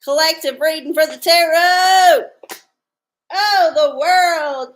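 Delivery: excited announcement. Real, not acted. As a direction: proclaim the card like a big reveal. Announcing the tarot reading.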